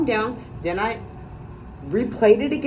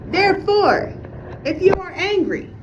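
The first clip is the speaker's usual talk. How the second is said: mock teacher to kids; 8 semitones higher than usual. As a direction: lecture like a teacher to children. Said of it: "remember, kids".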